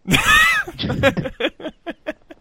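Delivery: high, long giggling laugh; burst of laughter. Real, not acted. Friend cracking up at the accent attempt, high laughter.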